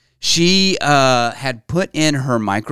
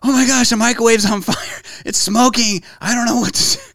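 higher, frantic wife on the phone; imitating panicked wife; 9 semitones higher than usual. Wife calling about the burning microwave, mock panic. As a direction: sound like she's panicking. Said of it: "She's like".

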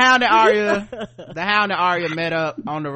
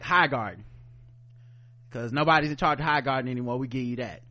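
voicing Tyrion; 7 semitones lower, 5 dB quieter, faster than usual. Tyrion voiced low.

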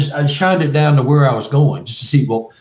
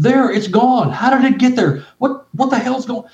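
panicky; 9 semitones higher than usual. Retold or imitated. Retold rising panic.

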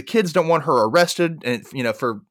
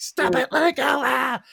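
high-pitched squabbling protest; mock struggle; 12 semitones higher than usual. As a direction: act out a tug-of-war, much higher. Acted struggle over the present.

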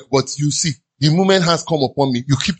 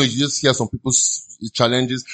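ordinary talk

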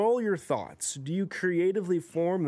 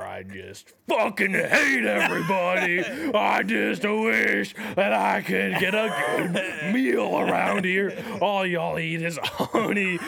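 loud, slow ranting donkey voice; Eeyore losing it; 4 semitones higher, 4 dB louder, slower than usual. Pretend. Eeyore finally snaps at his friends, loud angry rant. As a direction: finally blow up at everyone. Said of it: "lose his shit once".

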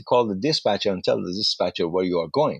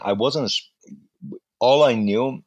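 usual speaking voice

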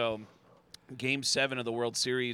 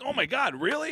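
high-pitched delighted disbelief; rising, mock-astonished exclamation; amazed reaction; 11 semitones higher, 3 dB louder than usual. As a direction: re-enact being pleasantly astonished. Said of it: "And I was like".

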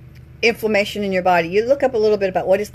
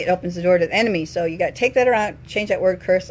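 usual speaking voice

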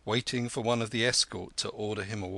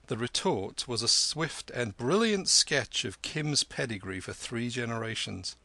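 plain reading voice

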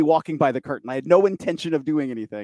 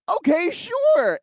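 hammed-up shouted agreement; mock eager okay; much slower than usual. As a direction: stretch it out like a cheerful yes.

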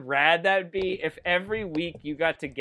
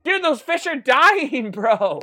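excited shout; 7 semitones higher, 6 dB louder, slower than usual. Real, not acted. Excited narration of wrestling chaos.

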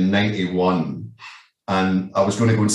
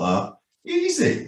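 breezy; 4 dB quieter, slower than usual. Retold or imitated. Retold naive reply.

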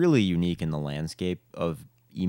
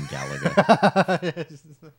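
laughing; 10 semitones higher, 7 dB louder, much slower than usual. Real, not acted. Catches friend playing game mid-podcast.

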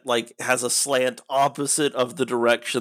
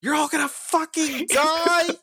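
panicked yell. Acted. Panicked warning to villagers.

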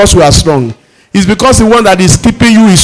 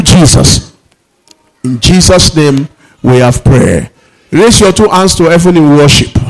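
ordinary talk

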